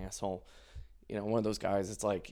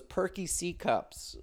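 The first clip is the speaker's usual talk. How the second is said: savoring request; 7 semitones higher, 4 dB quieter, slower than usual. Retold or imitated. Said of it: "And he goes".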